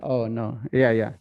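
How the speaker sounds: ordinary delivery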